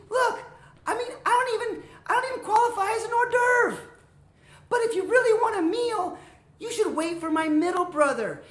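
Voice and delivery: high, squeaky-pitched frantic pleading; pleading little goat. Acted. Little goat bargaining with hungry troll, pleading high. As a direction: talk fast to save your skin, very high.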